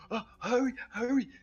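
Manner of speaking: high, hurried panicky plea; panicked urging. Acted. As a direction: urge them to flee. NPC urging escape.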